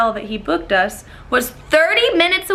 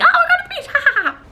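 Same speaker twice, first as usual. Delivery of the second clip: much higher, louder fake glee; mock cheerful; 3 dB louder, much faster than usual. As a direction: sing it with fake excitement. Sarcastic cheery quote.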